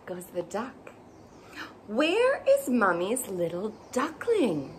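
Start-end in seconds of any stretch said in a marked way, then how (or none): none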